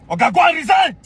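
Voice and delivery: high, loud order to quit; angry demand. Real, not acted. Telling the general to resign, a loud angry demand. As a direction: bark it like a final verdict.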